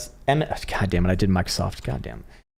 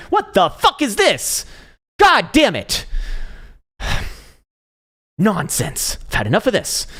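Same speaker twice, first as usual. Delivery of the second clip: mock rage; 12 semitones higher, slower than usual. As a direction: play a trader losing it over a chart, overdone. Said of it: "how some people actually get fucking mad at that".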